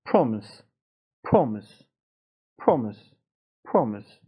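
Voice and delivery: پرومیس with a rounded British O; British pronunciation. Shown as a demonstration. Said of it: "تلفظ بریتانیاییش هم".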